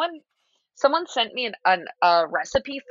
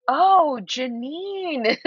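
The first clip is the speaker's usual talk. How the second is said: high, drawn-out catchphrase imitation; mock "Oh, Janine"; 6 semitones higher, much slower than usual. Co-host mimicking the show's reaction to Janine, exaggerated swooping exclamation. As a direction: swoop up high, then let the name fall. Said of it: "I always say it how".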